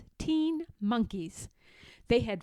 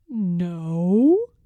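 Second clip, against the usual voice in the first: admitting; 4 semitones lower, 10 dB louder than usual. A narrator's reading. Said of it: "admitted the peddler".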